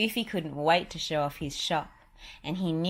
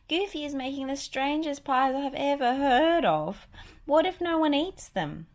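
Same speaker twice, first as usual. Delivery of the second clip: put-on high, louder fretting mouse girl; worried Minnie; 10 semitones higher, 6 dB louder than usual. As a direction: fret out loud, high and anxious. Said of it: "Minnie said later that night".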